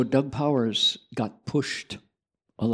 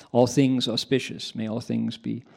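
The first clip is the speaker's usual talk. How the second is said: usual speaking voice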